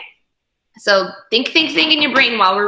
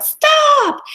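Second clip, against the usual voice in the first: parents yell; 5 dB louder than usual. Acted. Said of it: "Her parents yelled".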